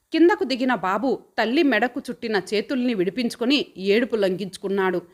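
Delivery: usual speaking voice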